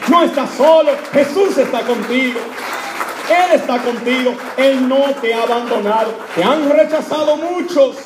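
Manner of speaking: loud, high, emphatic proclamation; preacher in full cry; impassioned preaching. Real, not acted. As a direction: proclaim it forcefully to the congregation. Preaches reassurance at full force.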